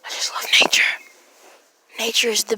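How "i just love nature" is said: whispering. Real, not acted. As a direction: whisper so the birds stay. Whispering outdoors by birds.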